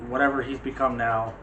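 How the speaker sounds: ordinary delivery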